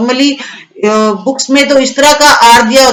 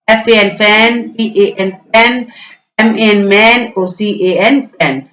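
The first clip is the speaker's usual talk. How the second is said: slow letter-by-letter spelling, then the word; spelling out slowly; slower than usual. Shown as a demonstration. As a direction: spell it slowly for children to repeat. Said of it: "जोर जोर से बोलना है इन words को". (धीरे-धीरे spelling बोलना)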